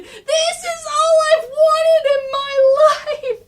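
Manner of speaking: high, loud, slow shout of pure joy; overjoyed yell. Acted. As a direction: scream it like a kid living his dream. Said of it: "he's going like".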